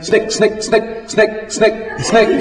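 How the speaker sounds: high, sharp imitated trigger clicks; imitated gun clicks. A narrator's reading. John pulling the trigger on empty, clicking sounds.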